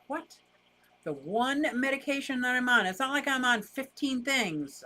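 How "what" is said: retold surprise. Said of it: "I'm like".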